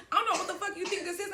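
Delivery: high, fast angry tirade; cursing him out in a heated rush; going off. Retold or imitated. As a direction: tear into him. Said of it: "I'm going the fuck off".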